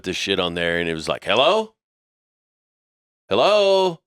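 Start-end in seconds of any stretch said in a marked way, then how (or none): 1.2-1.7 higher, questioning hello with a rising end; prank phone greeting. Retold or imitated. Recorded prank greeting fooling callers, questioning hello. Fake answering machine greeting. 3.3-4.0 much higher, louder second hello; insistent prank greeting. Retold or imitated. Recorded prank greeting fooling callers, insistent calling hello. Fake answering machine greeting, repeated.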